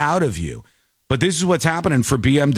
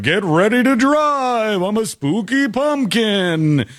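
a lightly higher, slow cartoon pumpkin; spooky pumpkin voice; 5 semitones higher, slower than usual. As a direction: play it like a Halloween car pumpkin greeting you. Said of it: "the spooky pumpkins go like".

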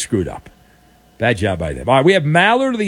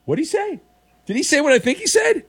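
incredulous; 11 semitones higher than usual. Real, not acted. Host incredulous at garbled caller.

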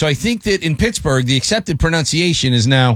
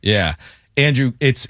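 normal talking voice

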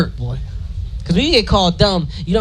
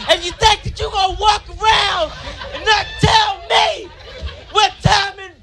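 furious mom shouting; 11 semitones higher than usual. Retold or imitated. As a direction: lose it at the boy. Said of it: "She's like".

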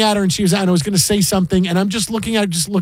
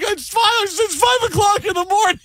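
high, whiny self-defense; husband whining his excuse to his wife in labor; pleading excuse. Retold or imitated. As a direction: plead it's too early to be blamed. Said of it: "I'm like".

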